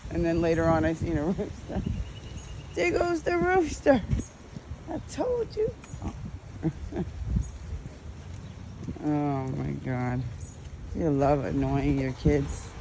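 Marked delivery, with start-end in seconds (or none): none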